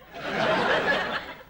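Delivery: breathy high giggle; wheezy giggle. Acted. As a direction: wheeze with naughty delight. Laughs at own joke.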